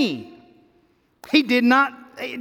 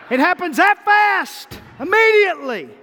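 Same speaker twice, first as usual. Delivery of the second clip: excited exclaim; 7 semitones higher, 4 dB louder than usual. Acted. Preacher exclaims speed.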